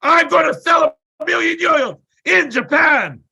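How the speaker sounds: high, loud full-energy shout; all-out yell. Retold or imitated. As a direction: bellow the goal with everything you have. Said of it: "this with all my energy".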